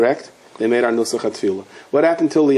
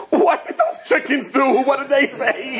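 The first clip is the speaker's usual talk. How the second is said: high, squeaky mock-awed question, near laughter; hammed-up high-pitched alarm for the punchline; frightened parrot; 11 semitones higher than usual. Shivering parrot asking about frozen chickens, mock-terrified. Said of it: "the parrot is still shivering".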